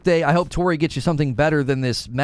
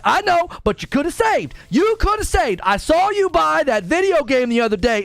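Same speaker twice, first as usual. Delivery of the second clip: high mock scolding girlfriend; 12 semitones higher than usual. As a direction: nag him, pitched way up. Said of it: "And she's like".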